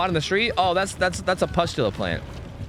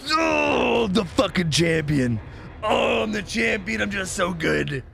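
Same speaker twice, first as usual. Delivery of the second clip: higher, slow hammed-up victory shout; over-the-top bragging; mock triumphant gloating; 6 semitones higher, slower than usual. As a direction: crow like a smug champion. Gloats after winning slug race.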